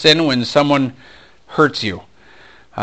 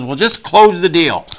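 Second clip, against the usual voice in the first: louder, higher angry insistence; mad manager; 7 semitones higher, 7 dB louder than usual. Retold or imitated. Manager mad at his salesman, snapping the order. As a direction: imitate a boss losing patience. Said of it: "then he gets mad at me".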